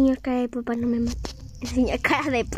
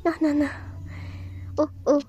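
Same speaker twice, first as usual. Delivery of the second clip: normal talking voice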